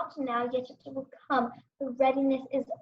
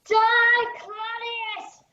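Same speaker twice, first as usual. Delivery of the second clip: much higher, loud battle cry; furious cry; 9 semitones higher, 4 dB louder, much slower than usual. Acted. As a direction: hurl it as he strikes. Hamlet strikes the king.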